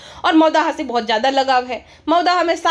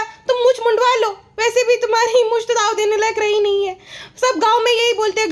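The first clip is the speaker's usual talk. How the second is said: much higher, syrupy put-on wife voice; mock sweet wife; 6 semitones higher than usual. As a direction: coo it like a doting vlogger. Wife voice again.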